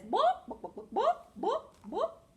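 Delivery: high, staccato clucking; chicken cluck imitation. Shown as a demonstration. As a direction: cluck like a hen in short bursts. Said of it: "A chicken says".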